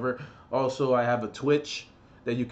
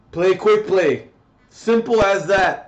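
loud repeat; 5 semitones higher, 8 dB louder, slower than usual. Real, not acted. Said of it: "Then play quick play".

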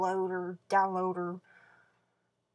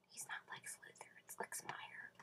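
whispered aside; 23 dB quieter than usual. Real, not acted. Vlogger whispering a correction about her friend's name to viewers. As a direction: whisper it, unvoiced, as a secret correction.

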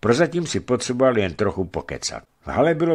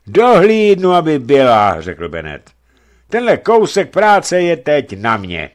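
much louder, higher leering boast; 8 semitones higher, 11 dB louder than usual. Acted. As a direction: brag it loud and drunk. Drunk sailor boasting loudly.

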